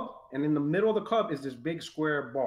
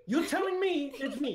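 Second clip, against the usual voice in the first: mock vindicated; 9 semitones higher than usual. Wife quoted, vindicated.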